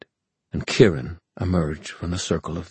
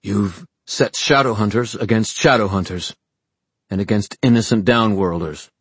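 louder, forceful public indictment; raised, emphatic accuser's voice; Julian, accusing; 4 semitones higher, 6 dB louder than usual. Acted. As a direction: build the charge, slowing on the last phrase. Story figure accuses.

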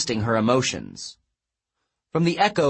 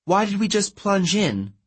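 high-pitched, anxious demand; 9 semitones higher than usual. A narrator's reading. Girl challenging her friend in the dark, demanding. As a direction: demand an explanation. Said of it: "Annabeth demanded".

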